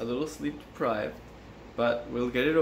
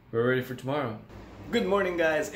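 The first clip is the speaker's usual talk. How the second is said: ordinary talk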